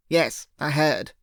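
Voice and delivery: slow, clipped phone voice of an irritated boss; testy. Acted. Associate on the phone, testily answering the caller. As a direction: let the annoyance show in a slow reply. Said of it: "the voice replied testily".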